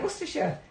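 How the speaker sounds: high, soft "Worcestershire" as WUSS-tuh-shuh; trying out Worcestershire. Real, not acted. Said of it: "have I said it right again".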